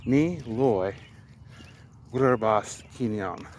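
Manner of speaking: "Ni loy Verbas Kinyam" tried slowly, word by word; slow careful attempt. Shown as a demonstration. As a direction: feel your way through the sentence. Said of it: "let me try the sentence here".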